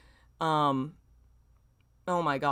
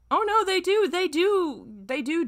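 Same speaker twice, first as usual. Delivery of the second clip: shocked realization; 14 semitones higher, 5 dB louder than usual. Real, not acted. Realizing the scene goes further.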